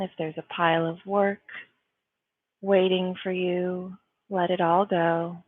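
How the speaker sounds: calm, spacious delivery with long pauses; guided meditation. Real, not acted. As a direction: guide them into stillness, phrase by phrase.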